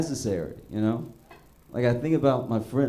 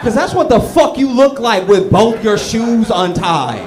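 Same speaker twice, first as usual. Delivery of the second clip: much higher and louder, cracking up; loud laughing rant; 10 semitones higher, 12 dB louder than usual. Real, not acted. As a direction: shout it through your own laughter. Ranting about untied shoes, laughing.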